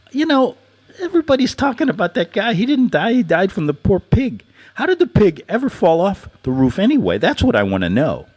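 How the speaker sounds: high, excited mock outrage; excited rant. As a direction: rattle it off all worked up. His own retold rant.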